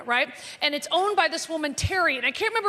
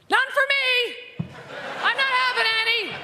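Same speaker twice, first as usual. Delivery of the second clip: prim refusal; 8 semitones higher than usual. Retold or imitated. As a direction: wave the tray away in a falsely virtuous voice. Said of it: "it's always the alkie that's going like this".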